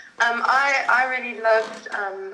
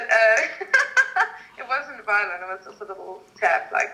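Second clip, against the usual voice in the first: normal talking voice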